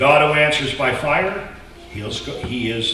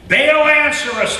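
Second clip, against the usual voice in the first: much higher, louder desperate cry; shouting to Baal; 13 semitones higher, 6 dB louder than usual. Acted. Baal's prophets cry out loudly for an answer. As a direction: call out to a silent god. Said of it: "they shouted".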